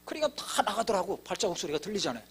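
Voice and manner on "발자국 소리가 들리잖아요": normal talking voice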